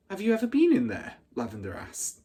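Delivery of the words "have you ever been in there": put-on higher, louder, quick child; little girl's question. Lavender asking the big girl, high and quick. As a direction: rush it out high and eager.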